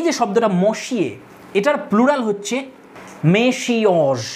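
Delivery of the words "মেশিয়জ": মেশিয়জ spread out as ME-SHI-OZ; pronunciation demo. Shown as a demonstration. Teacher modelling the plural of monsieur, মেশিয়জ syllable by syllable. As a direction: sound out মেশিয়জ clearly, sh and a long o. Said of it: "এরকম উচ্চারণ হয়".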